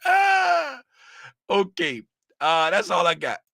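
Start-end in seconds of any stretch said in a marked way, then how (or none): 0.0-0.8 surprised cry. Real, not acted. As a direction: yelp at the chat. Reacts to chat.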